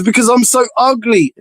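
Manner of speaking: high mock moan; self-pitying whine. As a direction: imitate an insecure guy moaning. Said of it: "He goes".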